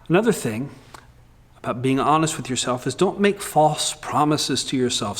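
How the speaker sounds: ordinary delivery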